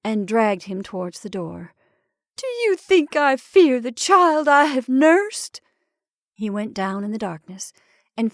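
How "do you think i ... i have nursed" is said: high, loud, slow outcry; anguished, defiant wail; impassioned defiance. A narrator's reading. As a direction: cry it out indignantly, drawing it out. Mother defies husband's warning.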